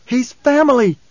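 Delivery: high, loud snap; snapped. A narrator's reading. Ghanima cutting Hara off, loud sharp snap. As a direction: bite the words off hard. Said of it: "Ghanima snapped".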